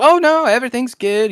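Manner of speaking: high, bright, overly polite phone voice; chirpy and falsely reassuring; customer service voice. Retold or imitated. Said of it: "customer service voice".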